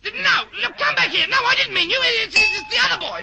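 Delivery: put-on high, loud call after a departing boy; the teacher calls the wrong boy back, high and loud; calling after him. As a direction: shout him back, flustered.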